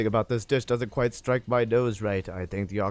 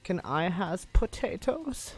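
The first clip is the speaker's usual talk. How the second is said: mock German voice, high and slow; put-on German accent; 6 semitones higher, 4 dB quieter, slower than usual. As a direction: ask in a goofy German accent. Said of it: "I don't know why he's German now".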